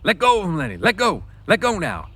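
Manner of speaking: loud, high pleading shouts; urgent shout. Acted. George stops Lenny crushing the hand, urgent shout. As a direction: shout him off the man. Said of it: "George ran down the room".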